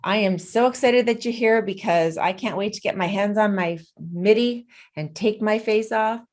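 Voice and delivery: normal talking voice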